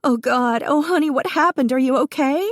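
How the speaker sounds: shaking and heaving, barely holding together; mother finding her hurt son, frantic and high; panicked. Acted. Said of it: "she was shaking so hard and her chest was heaving".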